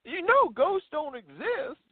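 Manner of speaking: high, slow mock Tennessee skeptic; Tennessee accent. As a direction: imitate a doubting local in a drawn-out Tennessee accent. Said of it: "trying to do my best Tennessee accent".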